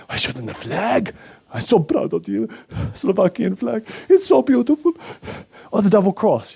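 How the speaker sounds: put-on a proud Eastern European accent, voice pushed up high; Slovak accent. Anton gloats about Slovakia's covid numbers in a high Slovak accent.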